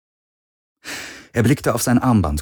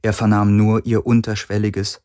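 plain reading voice

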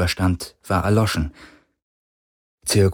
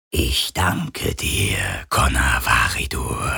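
low, slow icy voice; cold whisper; 8 semitones lower, slower than usual. Acted. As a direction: speak like cold from space. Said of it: "sagte eine flüsternde Stimme, kalt wie das All".

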